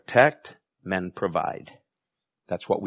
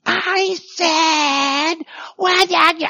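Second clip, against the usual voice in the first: mock shouting parent, pitched way up; parent yelling; much slower than usual. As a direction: yell like a frazzled parent at the kids.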